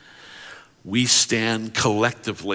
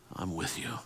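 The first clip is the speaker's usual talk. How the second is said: much softer, near whisper; hushed; 11 dB quieter than usual. Acted. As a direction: say it softly as comfort. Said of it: "whisper over top".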